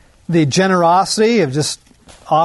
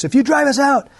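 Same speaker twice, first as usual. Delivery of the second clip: pleading demon; 10 semitones higher, faster than usual. Acted. Demons begging Jesus.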